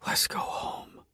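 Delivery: soft whispered words. A narrator's reading. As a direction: breathe it in a gentle whisper.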